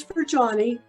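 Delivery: ordinary talk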